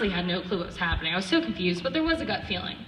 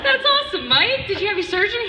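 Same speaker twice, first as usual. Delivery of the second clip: high, loud, laughing excitement; match his giddy energy, laughing; high excited reply; 12 semitones higher, 6 dB louder than usual. Retold or imitated. Sister answering her brother, loud high excitement. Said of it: "And I was like".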